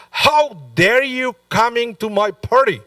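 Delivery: host yells at the uninvited guest, affronted; retold angry yell. Host shouting at his enemy Bar Kamsa, outraged. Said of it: "started to yell".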